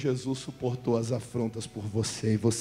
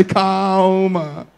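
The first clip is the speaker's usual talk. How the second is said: yelled, higher and higher, cracking at the end; mock calming shout; 8 semitones higher, 14 dB louder, much slower than usual. Shown as a demonstration. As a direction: scream "calma" ever harder, joking. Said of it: "fala pra ele".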